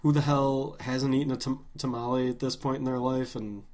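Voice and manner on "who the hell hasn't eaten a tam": ordinary delivery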